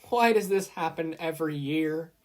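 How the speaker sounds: high, loud exasperation; Recovery Girl fed up with the two, high and loud; exasperated groan. A narrator's reading. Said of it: "banged her head on her desk".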